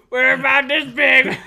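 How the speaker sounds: high, loud, drawn-out mock shout; goofy loud voice, laughing; laughing joke. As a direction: shout it slowly through laughter. Joking after the centimeters slip.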